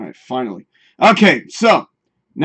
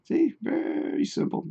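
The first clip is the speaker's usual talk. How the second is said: mock whisper; 13 semitones higher, 11 dB quieter than usual. Mock aside while showing trick.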